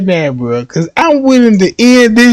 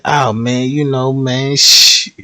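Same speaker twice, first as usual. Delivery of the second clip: sheepish excuse; 9 semitones lower, 5 dB quieter than usual. Retold or imitated. Boyfriend voiced making excuses.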